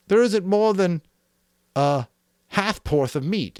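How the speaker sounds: high and loud, softening at the end; Piggy's complaint reread. A narrator's reading. Piggy repeating his grumble, high and loud. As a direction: bleat it, then let it drop.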